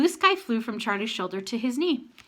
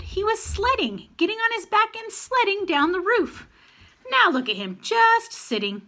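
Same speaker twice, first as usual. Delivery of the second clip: high, slowed, wondering voice; amazed; 11 semitones higher than usual. A narrator's reading. Charlie amazed at the raven sledding down the roof. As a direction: marvel at the bird's antics.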